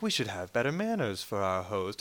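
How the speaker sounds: low, measured reproach; Thrawn. Retold or imitated. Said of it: "he mentions, like".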